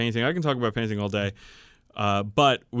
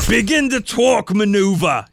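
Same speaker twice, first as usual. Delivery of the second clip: loud, higher orc boss shout; shouted order; 9 semitones higher, 7 dB louder than usual. Acted. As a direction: bark the order across the bridge. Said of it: "he shouted back".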